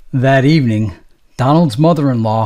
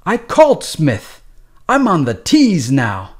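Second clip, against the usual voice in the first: higher, slow, irritated correction; irritated nurse; 5 semitones higher, 3 dB louder than usual. Acted. Tired nurse snapping at a patient, slow and high. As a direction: slap down his complaint. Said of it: "the nurse snapped".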